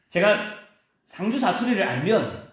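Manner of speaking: ordinary delivery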